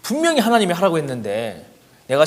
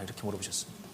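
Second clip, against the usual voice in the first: hushed aside; 7 semitones lower, 17 dB quieter, faster than usual. Real, not acted. Hushed aside after God's question.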